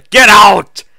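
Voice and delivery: loud high shaky yell; shaky scream. Acted. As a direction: throw her out with a sob in it.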